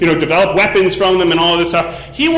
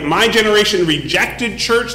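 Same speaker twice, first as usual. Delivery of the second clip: normal talking voice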